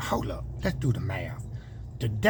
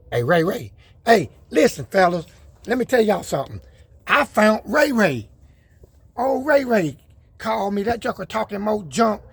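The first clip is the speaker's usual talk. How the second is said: high, loud shout-out to a buddy; light up calling your friend's name; excited call-out; 8 semitones higher, 4 dB louder than usual. Real, not acted. Vlogger shouting out friend Ray Ray, excited and high.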